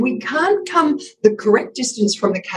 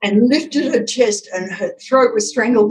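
ordinary talk, nothing marked

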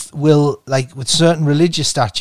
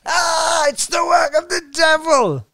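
hammed-up holy terror, voice up high; mock terrified shout; 14 semitones higher than usual. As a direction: shriek it like a spooked fool fleeing. Mock terrified fleeing from fountain.

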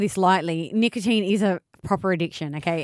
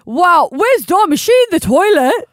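loud, high-pitched jolly questioning; Santa; 4 semitones higher, 7 dB louder than usual. Acted. Santa asks the co-host who is missing, jolly booming voice. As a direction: project like a pantomime Father Christmas.

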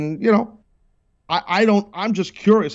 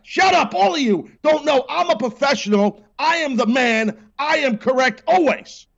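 much higher, hammed-up indignant yell; mock angry outburst; 12 semitones higher than usual. Host mock-yelling at his crew and caller, hammed-up anger. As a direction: bellow it like a pompous know-it-all.

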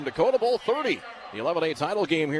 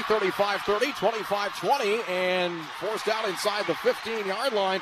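excited play-by-play; 5 semitones higher than usual. Real, not acted. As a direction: call the return as it happens, climbing higher. Live punt return call.

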